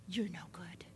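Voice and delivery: low hushed whisper of the father's cruel words; whispered quote. Retold or imitated. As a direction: whisper it like a secret you can hardly repeat. Quoting the father before the shot.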